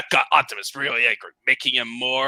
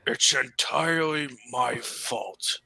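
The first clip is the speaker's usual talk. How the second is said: whispered; 7 dB quieter, slower than usual. Acted. Optimus voiced, whispered start.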